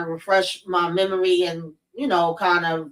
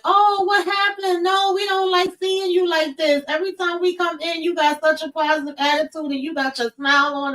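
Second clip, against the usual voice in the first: mock sweet nurse; 9 semitones higher than usual. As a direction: mimic the nurses cooing at her, pitched way up. Staff fussing over her mood.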